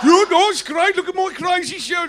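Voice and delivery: high, loud Brummie glam shout. Retold or imitated. Brummie rocker showing off his show, high and loud. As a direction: holler it like a glam rock Brummie. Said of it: "[name] in a vice".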